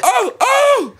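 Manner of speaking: panicked oh oh. Retold or imitated. Retelling his panic to friends, high frightened yelps. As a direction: gasp it out in sudden fright. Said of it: "So I'm like".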